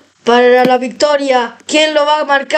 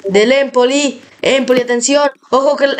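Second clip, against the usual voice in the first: ordinary delivery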